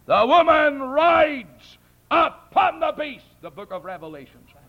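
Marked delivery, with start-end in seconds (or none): 0.1-3.3 high, loud, slow pulpit cry; full-force preaching. Real, not acted. Preacher quoting Revelation to the congregation, full-force preaching. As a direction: proclaim scripture in a drawn-out shout.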